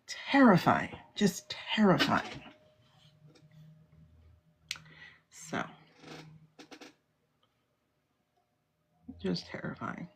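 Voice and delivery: slow, hushed whisper; whispered dread. Real, not acted. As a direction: let the fear sink into a whisper. Whispering horror of the memory.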